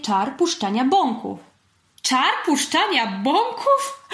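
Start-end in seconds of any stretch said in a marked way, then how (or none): 2.0-4.1 high, slow amused question; giggly. A narrator's reading. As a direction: echo it with a tickled, rising disbelief. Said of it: "zachichotał Pacyniusz". (rozchichotane pytanie)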